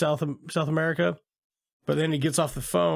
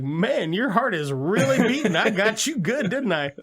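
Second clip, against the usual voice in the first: higher, gleeful gotcha voice; teasing prankster; 6 semitones higher than usual. Retold or imitated. To the startled man, a higher teasing gloat. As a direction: gloat that you got him good. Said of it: "He's like".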